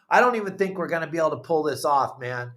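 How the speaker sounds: rushed, fretful retelling of Steve; fast worried quote. Retold or imitated. Friend retelling Steve's doubts, fast and fretful. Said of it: "He goes".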